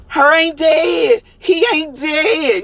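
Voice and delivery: high, slow, wailing vulture; mock sobbing vulture, pitched well up; whiny vulture voice. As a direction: drag it out like a crying scavenger.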